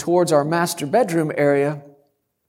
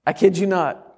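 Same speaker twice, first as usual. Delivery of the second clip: ordinary talk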